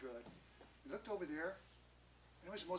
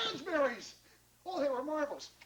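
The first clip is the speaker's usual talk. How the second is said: delighted exclamation; 6 semitones higher than usual. Acted. John tried another one, delighted. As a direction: exclaim it in sheer rapture.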